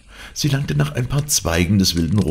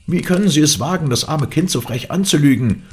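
high, loud outraged call; raised, high-pitched protest; indignant shout; 11 semitones higher, 5 dB louder than usual. A narrator's reading. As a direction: call out from behind the gate, scandalised. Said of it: "rief ich von drin".

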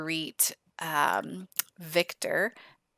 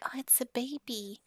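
put-on whispered, higher little-girl voice; voicing the toddler; 6 semitones higher, 10 dB quieter than usual. Toddler Eden coos over her new baby brother, whispered. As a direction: hush it like a toddler meeting a baby. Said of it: "She's like".

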